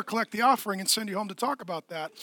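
ordinary delivery

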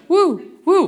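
very high, loud yelps; prey cries. Retold or imitated. Imitating panicky prey, very high and loud. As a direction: squeal like frightened prey. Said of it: "that sounds like prey".

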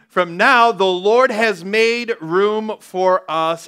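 said very slowly, word by word; slow emphatic reading. Shown as a demonstration. Preacher reading the verse slowly for weight. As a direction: lay out each word slowly. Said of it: "I should have highlighted that".

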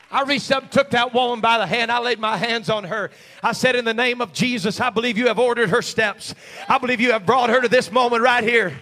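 loud, high, fervent shouted preaching; preacher pleading with the congregation, shouting with rising fervour; preaching at full force. Acted. Pastor begging the church to be the body, shouting.

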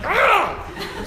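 high-pitched grunt of effort; hammed-up heave on a stuck strap; straining grunt. Priest heaving on the wheelchair strap, straining grunt. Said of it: "trying to pull his".

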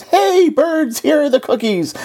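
high-pitched mild shout; storybook shout. A narrator's reading. Frog calls the birds to the cookies, a high mild shout. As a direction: call out high to faraway birds. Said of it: "he shouted in a loud voice".

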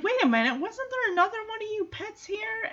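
a dog realising someone is missing; suspicious dog. Retold or imitated. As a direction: start puzzled and climb into a squeaky question. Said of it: "[name]'s one like".

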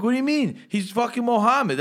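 high-pitched incredulous comeback; indignant arguing. Retold or imitated. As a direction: argue back in mock outrage. Acting out his side of argument.

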